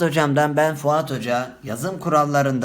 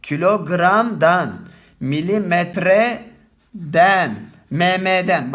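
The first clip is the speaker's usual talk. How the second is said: kilogramdan and milimetreden drawn out slowly; slow teacher's dictation; slow demo; 3 semitones higher, 4 dB louder, much slower than usual. Shown as a demonstration. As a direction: dictate each word slowly. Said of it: "Bu şekilde yazacaksın".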